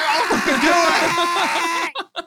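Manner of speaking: hammed-up screaming threat; mock furious scream. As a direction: scream it like a playful maniac. Mock threats screamed over call.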